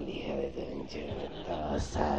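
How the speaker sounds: low, slow menacing growl; slow it down and threaten her; menacing man's voice. Acted. Guy in the hotel room threatening the woman, low and slow.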